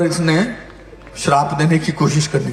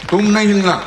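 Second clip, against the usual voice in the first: higher-pitched, firm repeated command; insistent order; 7 semitones higher, 4 dB louder than usual. Real, not acted. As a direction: order them firmly to stay put. Repeats order more forcefully to someone. (ज़ोर देकर, ऊँचे सुर में हुक्म)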